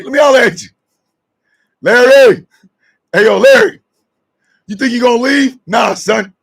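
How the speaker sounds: loud calls to stop Larry; yelling after him. Acted. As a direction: holler him back.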